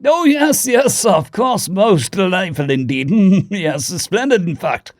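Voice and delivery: high, chortling upper-class British approval; posh British accent. A narrator's reading. Diplomat agrees about the plan, posh British accent. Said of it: "The posh upper-class British accent".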